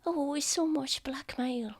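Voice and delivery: high soft whisper; whispering little child. Acted. Child weighing up blackmail, high whisper. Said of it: "Oh, I'm whispering".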